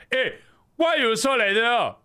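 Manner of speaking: high, loud Singlish accent; Singaporean accent. Shown as a demonstration. Streamer showing the assistant the line, Singaporean accent. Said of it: "do a Singaporean accent. I want you to say".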